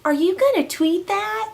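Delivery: high, squeaky little bird voice; cute little bird. Retold or imitated. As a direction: chirp it like a tiny cartoon bird. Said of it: "and the little bird goes".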